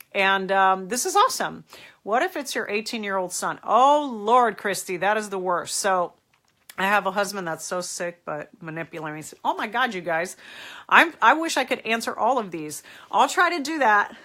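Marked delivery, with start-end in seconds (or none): none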